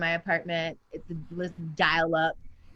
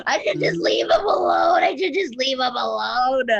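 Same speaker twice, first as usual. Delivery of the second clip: mock wailing; 5 semitones higher, 7 dB louder than usual. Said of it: "and I was like".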